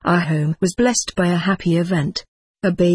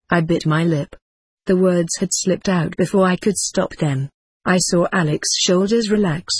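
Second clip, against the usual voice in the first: plain reading voice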